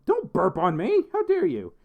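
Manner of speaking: high, loud pretend indignation; mock outrage. Streamer scolding a chatter over burps, mock outrage. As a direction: act playfully scandalised, pitch jumping up. Feigned outrage at chat message.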